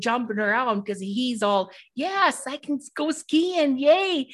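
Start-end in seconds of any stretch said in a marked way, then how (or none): 1.9-4.3 high, bouncy retelling of Danny's glee; excited cheer. Retold or imitated. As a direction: cheer like a kid who just won. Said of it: "And Danny's all excited".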